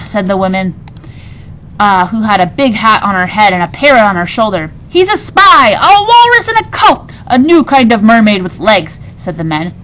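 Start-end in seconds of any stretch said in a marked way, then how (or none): 4.9-9.0 high, loud, wild accusations; excited accusing. A narrator's reading. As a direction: blurt out wild guesses. Pirates accuse narrator.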